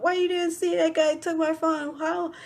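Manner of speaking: high-pitched mock chewing-out; imitated scolding mom. Mom chewing out her daughters, high and scolding. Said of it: "mom was chewing me and my sister out".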